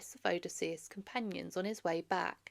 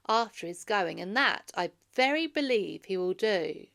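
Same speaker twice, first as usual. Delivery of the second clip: prophetic warning; 12 dB louder than usual. Acted. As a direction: warn them gravely of coming trouble. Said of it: "Then said a certain man".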